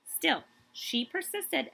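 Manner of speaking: slow, stress on Still; emphatic refrain. A narrator's reading. Reading Kate's story to kids, slow emphatic refrain. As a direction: lean on Still, then persisted.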